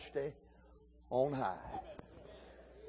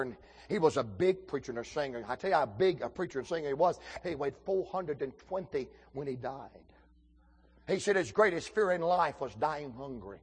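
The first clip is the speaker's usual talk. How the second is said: usual speaking voice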